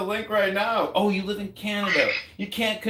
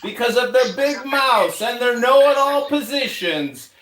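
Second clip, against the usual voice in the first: normal talking voice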